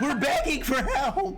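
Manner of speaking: laughing. Pretend. Laughing through the bit.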